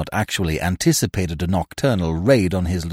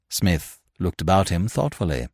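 ordinary delivery, nothing marked

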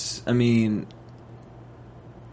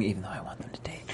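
whispered aside; 5 semitones lower, 16 dB quieter, faster than usual. Real, not acted. Host confiding she wants them to date, whispered. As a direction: drop to a near-silent whisper.

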